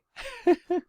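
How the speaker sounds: short, high, loud laugh; high squealing giggle. Real, not acted. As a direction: giggle helplessly at your own slip. Laughs again at own stumble.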